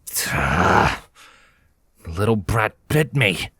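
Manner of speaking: low, angry hiss; hissed complaint about the bite; angered hiss. A narrator's reading. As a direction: spit it out like a wounded grudge. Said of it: "coming in a low, angered hiss".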